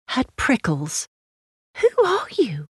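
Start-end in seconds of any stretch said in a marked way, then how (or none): 1.8-2.7 put-on high-pitched little girl; child's voice. Lucy questioning the stranger, high little-girl voice.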